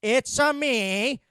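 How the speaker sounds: lazy parody of a Mario catchphrase; mocking Chris Pratt's Mario. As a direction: drag it out like a bad Borat-ish Mario. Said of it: "sounded like he was trying to do Borat".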